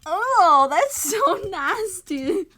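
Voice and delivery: loud laughing outcry; disgusted shouting. Real, not acted.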